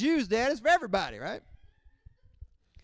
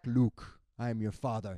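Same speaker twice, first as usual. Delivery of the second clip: a hint of Vader; Darth Vader quote; 6 semitones lower than usual. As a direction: lightly lower Vader voice. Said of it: "The Empire Strikes Back".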